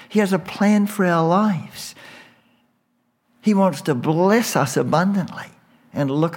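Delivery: high, impassioned preaching; soaring pulpit fervor, then softening; fervent preaching. Acted. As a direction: lift the voice in awe of God. Preacher marvels at God's love.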